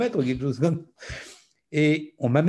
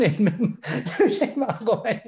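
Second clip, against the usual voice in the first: laughing through the punchline; chuckling as he tells it; amused laugh breaking into the words; 7 semitones higher than usual. Real, not acted.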